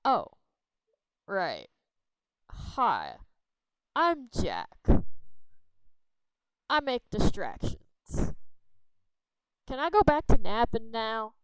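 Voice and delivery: Jack, introducing himself while wanting to nap; sleepy Jack. Acted. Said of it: "introduce yourself".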